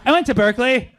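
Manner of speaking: loud, high smug boast; cocky. Pretend. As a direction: brag like a conquering hero. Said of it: "It's like".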